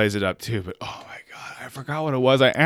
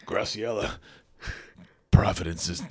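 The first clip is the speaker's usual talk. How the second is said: lower, soft, solemn bearer of bad news; hammed-up somber announcement; mock grave news; 5 semitones lower, 6 dB quieter than usual. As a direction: deliver it like a grim messenger.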